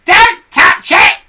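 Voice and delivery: very high, loud, slow witch screech; Mrs Twit crying out. A narrator's reading. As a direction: shriek the warning. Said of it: "cried Missus Twit".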